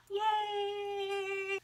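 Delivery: high, delighted squeal; squealing yay. Retold or imitated. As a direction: celebrate like a giddy fan. Queen commented on post.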